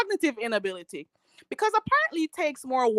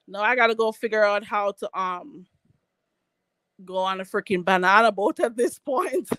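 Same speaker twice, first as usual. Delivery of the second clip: ordinary talk, nothing marked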